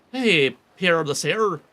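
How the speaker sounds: ordinary talk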